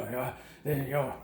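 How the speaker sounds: ordinary talk, nothing marked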